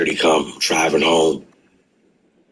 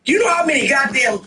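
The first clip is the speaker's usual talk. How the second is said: half-shouting, half-laughing disbelief; incredulous; 13 semitones higher than usual. Real, not acted. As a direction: voice shooting way up in disbelief. Aunt lost house to penny slots.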